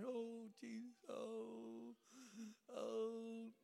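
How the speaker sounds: soft, high, drawn-out moans; imitated anguished prayer. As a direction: moan it out softly in prayer. Retold tearful prayer on the floor.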